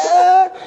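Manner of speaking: laughing yelp. Retold or imitated. Retold scream with laugh.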